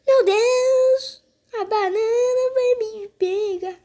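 high, terrified squeal; panicked high-pitched cry of fear; scared horror game streamer. Pretend. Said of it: "ele falou".

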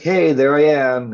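loud, slow showy hello; playing to the fans with a wink; fan service brag. Mock showoff announcing himself to fans, loud and slow. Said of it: "be fan service and be like".

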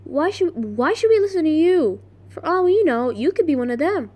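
acted distrustful challenge; Nancy. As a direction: throw the accusation out high and loud, easing at the end.

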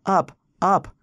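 high, loud bursts of command; urgent shout. A narrator's reading. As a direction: cry out frantically over the wind. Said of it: "Rass shouted into the howling wind".

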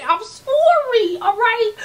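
high whiny sorry; pleading apology. Real, not acted. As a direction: beg forgiveness playfully.

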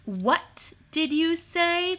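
slow, high, drawn-out question; deliberate repeat. A narrator's reading. Timmins repeats his question in the silence, slow and deliberate.

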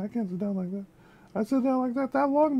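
ordinary talk, nothing marked

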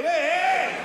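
high, loud drawn-out cheer; jeering cheer. Acted. Onlooker wishing he had filmed the fall, jeering whoop. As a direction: whoop it like a heckling workman. Acted jeer at fallen man.

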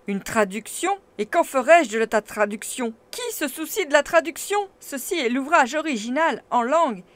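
loud, scornful professor; brisk retort. A narrator's reading. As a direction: snap back with contempt. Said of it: "riposta vivement".

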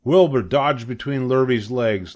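usual speaking voice

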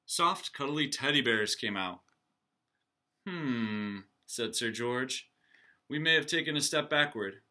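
lively read-aloud for the class; storybook reading. A narrator's reading. Teacher reading a picture book aloud to her class. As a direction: read it like story time for kids.

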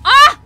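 sudden loud yelp of fright; a real startled cry. Real, not acted. Startled by sparks in game.

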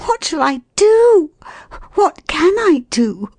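very high, wailing woman's plea; helpless dismay. A narrator's reading. Stranded woman at a loss before the man, despairing. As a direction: wail it helplessly, pitched right up.

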